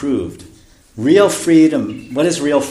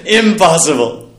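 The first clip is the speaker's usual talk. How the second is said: higher, louder British protest; British accent; 4 semitones higher, 6 dB louder, slower than usual. Retold or imitated. English lord repeats his refusal to the guru, in a British accent. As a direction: stretch it out like an outraged British lord. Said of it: "It is best British accent".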